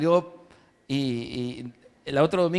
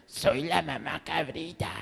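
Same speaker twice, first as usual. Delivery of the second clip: hoarse wolf; 7 dB quieter than usual. Acted. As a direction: rasp it out like a wolf in disguise. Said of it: "por la voz ronca".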